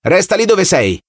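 distant authoritarian voice giving commands; commanding telescreen voice. Acted. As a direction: order him like a cold loudspeaker. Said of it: "disse la voce".

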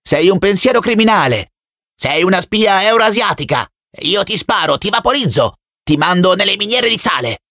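put-on high, fierce child's threats; boy shouting. A narrator's reading. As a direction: threaten him like a little fanatic. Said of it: "gridava il ragazzino". (grida minacciose di bambino, acute)

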